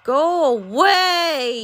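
loud, high, very slow yell; drawn-out yell. Real, not acted. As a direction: stretch it out as you shout the enemy off. Yelling at game enemy.

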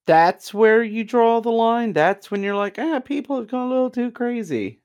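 high, disbelieving retort; mock him in disbelief; incredulous. Real, not acted. Incredulous jab at friend.

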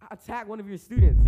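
loud incredulous repeat of the accusation; indignant retort. Retold or imitated. Boy retelling arguing with the principal, loud indignant retort. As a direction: throw the words back at him, outraged. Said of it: "I'm like".

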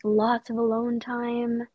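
ordinary delivery

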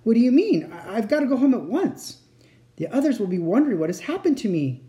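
mildly panicked and anxious to leave; rather frightened. A narrator's reading. Lucy realizing she may not get home, frightened. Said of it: "feeling rather frightened".

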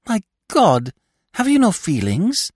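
high, loud, indignant appeal; reproving daughter. A narrator's reading. Climene to her father as he hurts Scaramouche, reproving. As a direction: reprove him in a high, outraged voice. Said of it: "she reproved her father".

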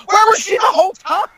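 real indignant yelp at a movie hole; incredulous outcry. Real, not acted. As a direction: shout it in real disbelief. Outraged plot complaint.

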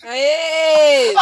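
hoarse, strained yell; hoarse shout. Real, not acted. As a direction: shout through a rough, hoarse throat. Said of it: "minha voz tá muito rouca".

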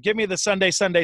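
ordinary delivery

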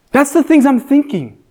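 normal talking voice

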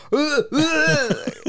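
squeaky laugh burst; high laugh. Real, not acted. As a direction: crack up laughing. Cracking up at story.

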